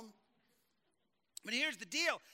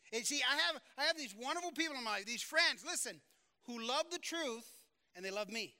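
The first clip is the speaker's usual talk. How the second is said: ordinary delivery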